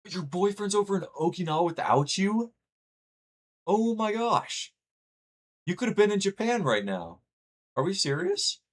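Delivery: can't believe what chat just said; astonished. Real, not acted. Streamer shocked at a viewer's boyfriend abroad, high amazed disbelief. Reacting to chat's news, astonished.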